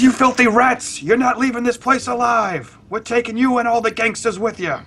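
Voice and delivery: high, strained shout from a window; defiant villain's threat. Acted. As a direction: rage at the attackers below in character.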